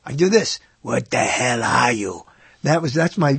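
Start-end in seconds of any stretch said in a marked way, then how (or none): 0.8-2.2 put-on slow, lower Austrian-accented Arnold; Arnold Schwarzenegger impression. Host doing his Arnold line from Predator, slow Austrian accent. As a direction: growl it out slowly like Arnold in Predator. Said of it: "my Arnold Schwarzenegger from The Predator".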